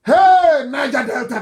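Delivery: a sudden loud, high shout; calling out. Real, not acted. The preacher shouting at his listeners to grab attention, loud and high.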